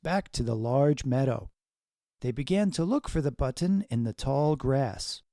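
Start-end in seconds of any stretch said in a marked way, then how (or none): none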